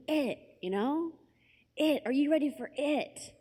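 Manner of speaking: "it" set apart and stretched, high insistent voice; stress on IT. Retold or imitated. Friend hinting to a clueless girl, pointed stress on "it". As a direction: hit each "it" as a heavy hint. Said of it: "he keeps emphasizing this word, it".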